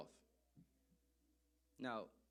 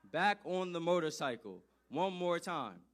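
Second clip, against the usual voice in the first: loud, slow, exasperated cry; a much louder, drawn-out exasperated exclaim; exasperated outburst; 4 semitones higher, 12 dB louder, slower than usual. Acted. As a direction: belt it out in disbelief. Exasperated reveal of repeat offence.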